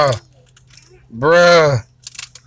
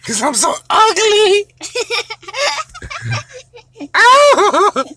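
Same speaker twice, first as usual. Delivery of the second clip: higher laughing fake cry; laughing mock sob; 12 semitones higher, slower than usual. Dad to kid, laughing through a fake sob. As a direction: giggle through a pretend sob.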